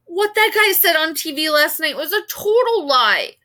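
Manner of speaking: loud, high indignant rant; angry rant. A narrator's reading. Kaya raging about the TV interview, loud indignant rant. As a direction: insist it's all lies.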